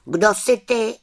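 put-on high, loud curious boy; boy asking. A narrator's reading. Tomáš asks the witch who she is, high and curious. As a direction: press her for an answer, boyishly.

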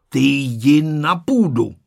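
loud, slow, firm command; stern order. A narrator's reading. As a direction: order the cat away sternly, spacing the words. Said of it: "poručil mu přísně dědeček". (přísný, důrazný rozkaz)

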